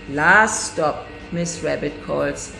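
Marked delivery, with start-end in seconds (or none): none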